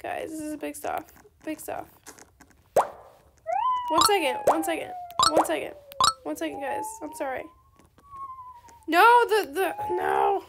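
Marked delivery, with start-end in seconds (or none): 2.8-4.4 drawn out, sung high and slow; long singsong hold please; one second. Real, not acted. Asking viewers to wait, drawn out. 8.9-10.4 a little distressed whimper; gamer's pained whine over a trade; whiny cry. Real, not acted. Trade going wrong, whiny cry.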